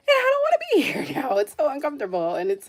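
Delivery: half laughing, half fed up with being stuck; laughing while complaining. Real, not acted. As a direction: laugh through the words, fed up. Laughing at her own frustration.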